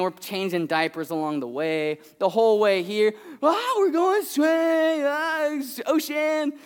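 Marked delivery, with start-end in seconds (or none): 3.4-6.5 put-on high, slow, wide-eyed child; excited little girl. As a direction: stretch it out high like a thrilled toddler. Daughter voiced, excited.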